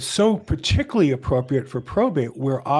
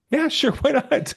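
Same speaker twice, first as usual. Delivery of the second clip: laughing reply; 10 semitones higher than usual. Retold or imitated. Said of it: "And I said".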